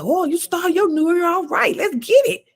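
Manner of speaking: high, hyped-up imitation of the driver; mock Uber driver. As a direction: squeal it like the driver hitting the gas. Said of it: "And she said".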